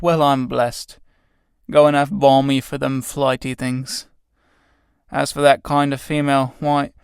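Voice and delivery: rough tramp voice, slowing at the end; cockney tramp. Acted. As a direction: grumble like an old tramp. Tramp figure's voice.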